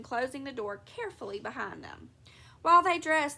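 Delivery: usual speaking voice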